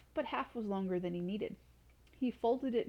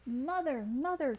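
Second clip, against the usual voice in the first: higher calling for mother; 6 semitones higher, 4 dB louder, slower than usual. A narrator's reading. Son runs in calling his mother, higher. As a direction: call out across the castle. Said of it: "called out Radius".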